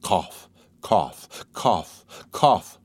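rough racking coughs; violent coughing. Acted. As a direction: cough hard, struggling for breath. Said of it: "and she coughed violently".

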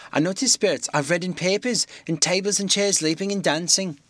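put-on a frightened old landlady. Mrs Hall, frightened.

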